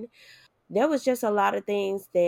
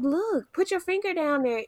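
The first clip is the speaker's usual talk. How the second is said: nurse urging; 8 semitones higher than usual. Retold or imitated. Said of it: "they was like".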